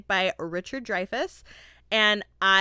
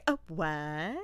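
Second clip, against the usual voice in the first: feminine "a what"; 5 semitones lower, 7 dB quieter than usual. Retold or imitated. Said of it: "a very feminine, "A what?".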